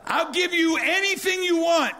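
the king promising her anything; eager king. Retold or imitated. King's offer to Esther.

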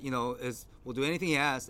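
usual speaking voice

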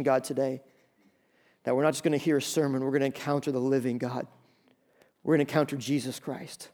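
soft, hushed pulpit prayer; earnest prayer. Acted. Pastor praying over the standing congregation, hushed and earnest. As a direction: pray quietly over the standing church.

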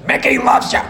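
put-on high, strained old trainer voice; put-on trainer's rough affection; Mickey impression. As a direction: cry it out to your fighter. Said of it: "What does he say?".

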